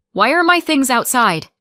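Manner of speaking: loud and high, demanding an answer. A narrator's reading. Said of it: "I demanded".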